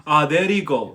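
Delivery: ordinary talk, nothing marked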